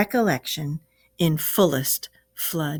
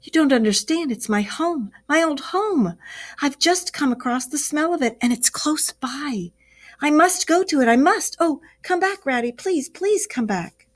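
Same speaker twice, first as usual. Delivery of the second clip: high, urgent begging for home; desperate plea, voice climbing; begging friend to come back; anguished pleading; 7 semitones higher than usual. A narrator's reading. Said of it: "pleaded the poor Mole in anguish of heart".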